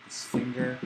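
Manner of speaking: ordinary talk, nothing marked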